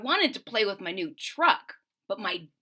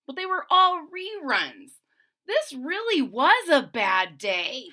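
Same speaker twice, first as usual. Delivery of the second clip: higher, louder whine about a bad day; whiny complaint; 5 semitones higher, 4 dB louder than usual. Child complains about reruns.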